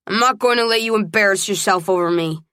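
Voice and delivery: plain reading voice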